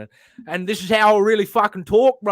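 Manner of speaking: hammed-up Aussie bloke insisting it's real; cheeky exaggerated Australian voice; Australian accent. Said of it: "full tilt that impression".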